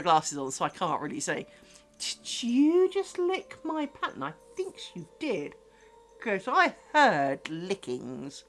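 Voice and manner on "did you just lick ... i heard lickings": soft teasing to a pet. Real, not acted. Mock-scolding cat in soft voice.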